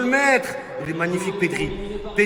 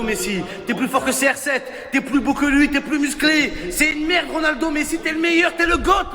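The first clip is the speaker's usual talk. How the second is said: usual speaking voice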